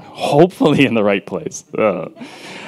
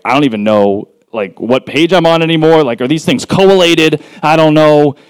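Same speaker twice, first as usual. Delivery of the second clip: raised, louder comic confusion; playfully hammed-up fluster; mock bafflement; 5 semitones higher, 4 dB louder than usual. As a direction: act out being lost in your own notes. Reenacts losing place in double-sided notes.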